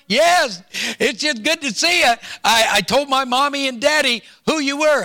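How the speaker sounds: schoolboy telling the pastor who he is, high and eager; young boy voice. Retold or imitated. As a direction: pitch it up like a third grader answering. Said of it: "this young boy's voice".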